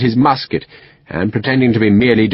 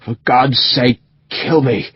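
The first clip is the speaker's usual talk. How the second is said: half-crazed plea; slower than usual. A narrator's reading. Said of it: "half crazed with pain".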